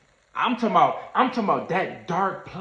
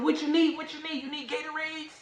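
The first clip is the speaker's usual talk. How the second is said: high, much higher, fussing voice; mock fussing staff; 12 semitones higher, 6 dB quieter than usual. Golf shop staff fussing over him, voiced high. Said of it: "They talking about".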